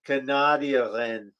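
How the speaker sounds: "Canadian" drawn out as "ca-NAH-dian"; slow "Canadian" with an "ah" in the middle, laughing; mock mispronounced Canadian. Shown as a demonstration. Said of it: "as they say in my country".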